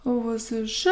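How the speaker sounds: ordinary talk, nothing marked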